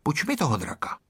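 softly voiced asking; hushed, discreet little plea; quiet request. A narrator's reading. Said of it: "řekl tiše".

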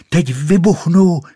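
loud, high cry before bursting; shouting. A narrator's reading. Rocket feels she will explode, shouting. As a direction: shout it high at full tilt. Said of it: "křičela".

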